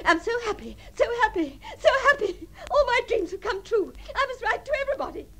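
high, giddy elation, climbing with each repeat; rapturous, near-laughing delight; overjoyed. Acted. Dictating joyful engagement telegram.

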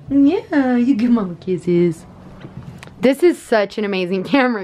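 ordinary talk, nothing marked

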